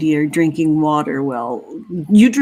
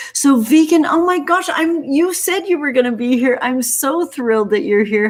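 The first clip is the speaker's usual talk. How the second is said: much higher, squealing with delight; thrilled; 7 semitones higher than usual. Real, not acted. Greeting a friend in chat, high and thrilled. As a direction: burst out with joy at a friend.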